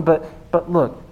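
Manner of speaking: ordinary talk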